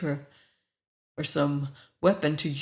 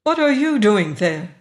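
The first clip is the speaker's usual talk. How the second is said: louder, haughty challenge; 3 semitones higher, 7 dB louder than usual. A narrator's reading. Woman demands why the stranger is there, haughty. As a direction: question the trespasser from on high. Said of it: "The voice was haughty now".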